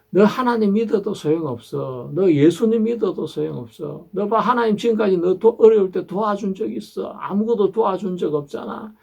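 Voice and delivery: high, pressing mock devil voice; devil's taunting. Preacher voicing the devil taunting believers, high and pushy. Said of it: "마귀는 우리에게 이야기하는 것입니다".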